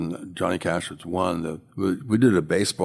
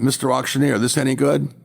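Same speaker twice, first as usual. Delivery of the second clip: higher-pitched mock yell; 7 semitones higher, 4 dB louder than usual. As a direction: imitate calling out to the auctioneer across a room. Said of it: "he yells out".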